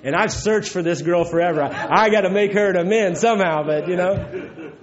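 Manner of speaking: laughing. Real, not acted. Confessing to the room about the girl he owes amends, laughing through it.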